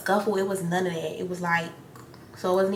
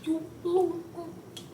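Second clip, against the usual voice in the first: high, soft, smirking mock; imitating a smirking taunt, pitched high and soft; 9 semitones higher, 5 dB quieter than usual. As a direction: taunt with a smirk you can hear. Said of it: "she was laughing. She was smirking".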